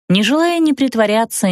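ordinary delivery, nothing marked

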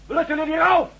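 high, loud, defiant yell; defiant shout. Acted. Mitch shouts defiance at the gang outside, high and loud. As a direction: throw the challenge right back at them.

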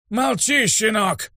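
высокий злой голос старика; осади ученика яростно, не прибавляя громкости; яростный окрик. A narrator's reading. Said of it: "яростно крикнул старик". (яростный стариковский окрик)

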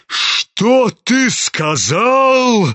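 high, very slow outraged demand; drawn-out theatrical outrage; thundering. A narrator's reading. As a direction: stretch it into a thunderous question. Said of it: "загремел генерал".

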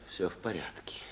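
whispered reassurance. Acted. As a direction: breathe it softly, no voice. Hushed reassurance at night.

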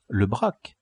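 ordinary delivery, nothing marked

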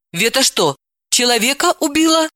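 confused question, rising high; stunned, can't take it in; bewildered. Acted. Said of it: "растерянно спросила она".

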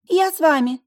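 high, loud eager cry; eager roommate. A narrator's reading. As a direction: pipe up loudly to join in. Roommate leaps up to join. (звонкий выкрик высоким голосом)

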